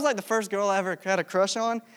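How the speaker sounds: normal talking voice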